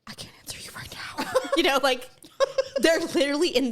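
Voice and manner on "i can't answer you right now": whisper. Retold or imitated. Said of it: "they were like".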